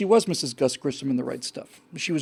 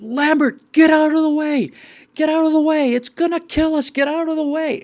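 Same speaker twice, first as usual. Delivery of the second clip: much higher and louder desperate yell; screaming warning; 11 semitones higher, 8 dB louder than usual. Retold or imitated. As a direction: shout it like he's trying to save her life. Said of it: "Parker is screaming".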